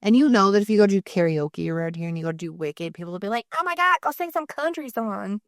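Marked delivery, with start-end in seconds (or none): 3.6-5.4 mocking local. Karaoke crowd mocking her.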